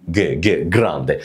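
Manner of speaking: "ج" repeated low as hard "geh", then "جراندي"; hard g demonstrated. Shown as a demonstration. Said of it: "هنطقه إيه؟".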